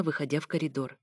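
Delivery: plain reading voice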